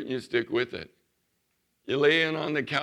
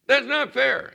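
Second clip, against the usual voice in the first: put-on high, loud childish protest; nasal whine; 12 semitones higher, 7 dB louder, slower than usual. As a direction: whine it like a wronged kid. Child protesting father's count.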